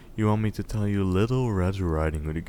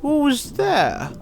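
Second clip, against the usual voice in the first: much higher, louder quavering granny; old granny; 6 dB louder than usual. Acted. Grandmother in bed answers a knock, high old voice. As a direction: call out like a frail old woman. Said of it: "asked the old dame".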